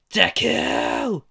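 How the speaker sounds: high, loud shout of the name; furious yell. Acted. Bakugo screaming at Izuku after blowing up his desk. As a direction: bark the name in rage.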